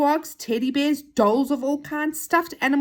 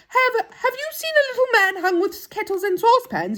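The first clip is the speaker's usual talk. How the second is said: high-pitched, raised questioning voice; inquiring storybook character; 12 semitones higher, 5 dB louder than usual. Acted. Moonface asks a gollywog about his missing friend, high and eager. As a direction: ask a stranger in a high, loud character voice.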